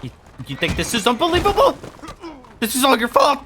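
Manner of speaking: high, loud rising rant; high-pitched, full-volume outburst; outraged yelling. Acted. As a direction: shout the blame at them, voice climbing. Yelling blame during the game.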